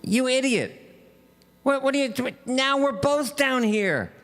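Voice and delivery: high-pitched, exasperated outcry; mock exasperation. Trapped man scolds the friend who jumped in, mock exasperation. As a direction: berate a friend in a high voice, hammed-up.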